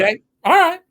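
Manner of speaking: high, loud all right; mock giving in. Teacher caves to doubting students, loud and high. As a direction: cave in, hammed-up. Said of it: "repeats reply".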